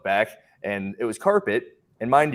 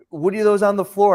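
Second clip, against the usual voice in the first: higher, louder mock demand; retold complaint in a raised voice; indignant woman; 11 semitones higher, 6 dB louder than usual. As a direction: imitate the shopper's accusing question. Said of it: "which is".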